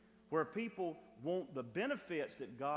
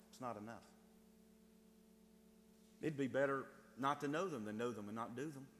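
ordinary talk, nothing marked

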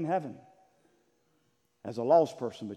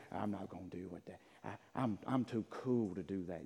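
too-cool mimicry; 6 semitones lower, 8 dB quieter than usual. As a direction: mutter it like you're too cool. Mimics someone too cool to respond.

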